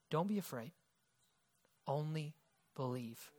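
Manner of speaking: almost breathe the words of comfort; Jesus tells the grieving father, hushed and gentle; soft reassurance. Retold or imitated. Quoting Jesus to Jairus.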